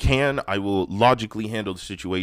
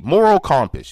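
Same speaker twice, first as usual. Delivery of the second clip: Moral compass said high and loud; emphatic, louder; 7 semitones higher, 8 dB louder than usual. Real, not acted. Repeats phrase for emphasis.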